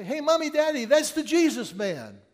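put-on high, loud child's voice calling to her parents; a child spotting someone and shouting to Mommy and Daddy; little girl. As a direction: squeal it out like an excited little kid. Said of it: "I heard this little girl say".

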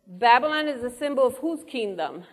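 ordinary delivery

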